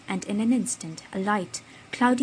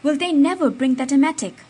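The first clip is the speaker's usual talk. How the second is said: louder, higher impatient call; urgent call, louder; urgent demand; 6 semitones higher, 10 dB louder than usual. A narrator's reading. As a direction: shout for the emetic. Urgent doctor demands emetic.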